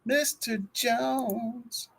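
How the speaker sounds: stretched long and soft; slow drawn-out echo. Real, not acted. Echoing the shoutout.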